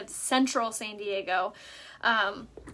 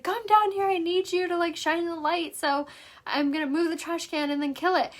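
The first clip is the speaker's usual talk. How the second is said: husband's orders; 6 semitones higher than usual. Retold or imitated. Husband ordering her to hold the light, high mock voice. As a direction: play the husband barking orders. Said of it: "And my husband's like".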